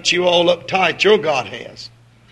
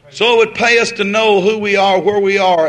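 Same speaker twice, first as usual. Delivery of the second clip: ringing exhortation; 4 semitones higher, 8 dB louder than usual. Real, not acted. Sermon climax.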